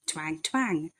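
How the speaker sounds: ordinary delivery, nothing marked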